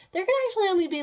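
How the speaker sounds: sly intrusion. Retold or imitated. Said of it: "she's like".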